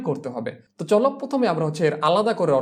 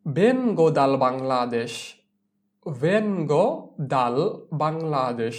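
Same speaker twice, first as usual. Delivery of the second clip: very slow, word by word; slow model phrase; 2 semitones lower, much slower than usual. Shown as a demonstration. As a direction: break it up slowly for learners. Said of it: "teacher models new sentence".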